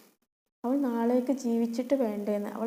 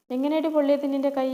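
harsh demand; 4 semitones higher, 7 dB louder than usual. Acted. Stepmother demands answer.

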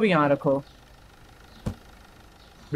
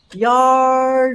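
whiny groan; 8 semitones higher, 5 dB louder, much slower than usual. Real, not acted. Frustrated at license level.

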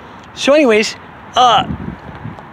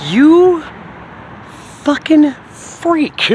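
retold yell; 8 semitones higher, much slower than usual. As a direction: yell You fucking freak at her, stretching it. Retold yelling at his sister.

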